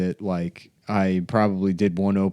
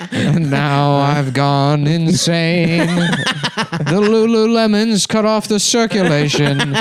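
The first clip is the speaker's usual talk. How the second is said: put-on slow, high, laughing old man rant; drawn-out crazed old man; 4 semitones higher, much slower than usual.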